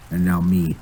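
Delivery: ordinary delivery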